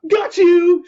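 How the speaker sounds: loud, high gotcha; playful shout. Acted. Puppy finding the hidden skunk, shouting playfully. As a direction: pounce with a shout. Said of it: "shouted Cookie".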